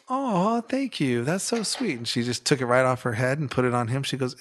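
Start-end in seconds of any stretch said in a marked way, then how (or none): 0.1-2.0 high, swooping "oh" of gratitude; imitated touched delight. Lady thanking boy for his compliment, imitated delight. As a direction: mimic a woman charmed by a child. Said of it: "And she was like".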